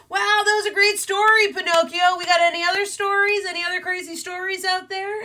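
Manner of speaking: high, loud, over-eager dad voice; distracting parent. Wolf dad distracting the kids from his carving, forced cheer. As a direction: oversell the enthusiasm to divert the kids. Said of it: "parents do while they're trying to, like, distract the kids".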